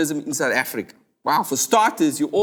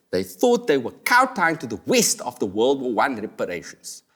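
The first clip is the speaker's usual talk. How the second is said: ordinary talk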